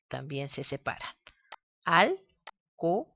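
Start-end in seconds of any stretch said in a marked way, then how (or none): 1.8-3.1 Alcohol stretched out slowly, vowels pulled apart; slow syllable split. Shown as a demonstration. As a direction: drag out Alcohol to show the split. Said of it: "Si la separamos". (Alcohol lento, separando las vocales)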